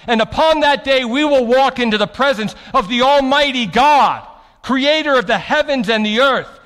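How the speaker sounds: loud, driving pulpit proclamation; rising fervent sermon climax; preaching at full force. Acted. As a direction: preach it out to the whole room, full volume.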